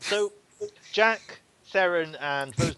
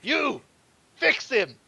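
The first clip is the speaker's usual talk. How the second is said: much higher, loud command; barked order; 11 semitones higher, 7 dB louder, much slower than usual. Acted. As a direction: bark it at the healer. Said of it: "and goes".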